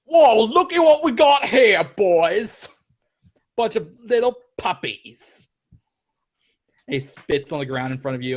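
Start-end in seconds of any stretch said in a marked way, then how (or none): 0.0-5.5 high, loud, slow mocking thug voice; thug shout. Gang thug jeering at the adventurers, loud and mocking. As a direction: jeer at the party like a street tough. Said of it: "and he's like".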